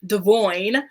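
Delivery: "Devoyne" repeated with a clear OY; corrected pronunciation. Shown as a demonstration. Host repeats the corrected name, "Devoyne" said duh-VOYN. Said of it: "pronouncing his name correctly".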